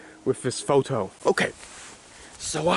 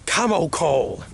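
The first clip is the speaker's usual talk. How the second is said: normal talking voice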